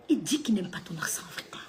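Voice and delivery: soft whisper, leaning in; hushed confiding whisper; whispered gossip. Acted. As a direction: whisper it like a scandal. Repeats the secret whispering.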